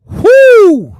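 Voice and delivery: loud high whoo at the spice; a sharp high cry from the burn; whoo from the heat. Real, not acted. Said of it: "my mouth is on fire".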